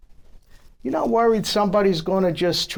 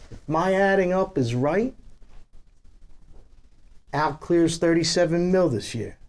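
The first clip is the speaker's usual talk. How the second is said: ordinary delivery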